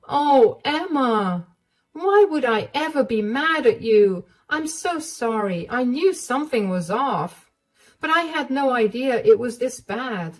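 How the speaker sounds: caring mother-in-law. Acted. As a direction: reassure her she's not angry.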